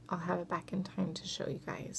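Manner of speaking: soft whispered promise; hushed reassurance. Real, not acted. Promising to show the dress.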